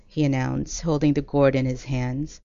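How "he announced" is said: ordinary delivery, nothing marked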